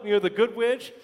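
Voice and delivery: normal talking voice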